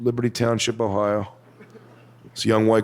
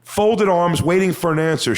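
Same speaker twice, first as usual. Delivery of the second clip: ordinary talk, nothing marked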